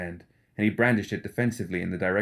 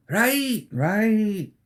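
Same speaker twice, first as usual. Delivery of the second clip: much higher startled repeat; McMorning, flustered; 13 semitones higher, 4 dB louder than usual. Acted. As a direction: snap back to attention, rattled.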